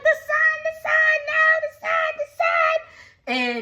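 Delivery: very high, wailing mock panic; mock panicked vampires. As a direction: wail like vampires fleeing the sunlight. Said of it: "They're running, they're like".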